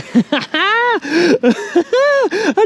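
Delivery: high, long whooping laugh; delighted cackle. Real, not acted. Angler celebrating a fish on the line, delighted cackle.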